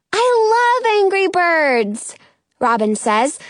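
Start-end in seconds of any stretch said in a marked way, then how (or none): 0.1-2.2 high, drawn-out delight; enthusiastic. A narrator's reading. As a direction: gush about a favourite game. Robin enthuses about a game.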